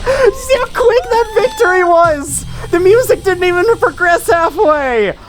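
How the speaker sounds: high, thrilled shouting with laughter; excited yelling. Real, not acted. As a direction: gush over the win, near breaking. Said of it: "Sorry for yelling so loud".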